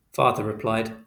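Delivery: usual speaking voice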